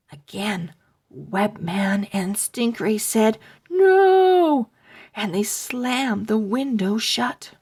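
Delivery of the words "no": high loud panicked shout. Acted. Boys refusing the zombie again, shouting high. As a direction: yell it and slam the window.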